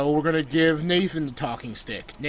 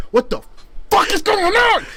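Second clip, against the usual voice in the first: shocked. Retold or imitated. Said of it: "I'm like".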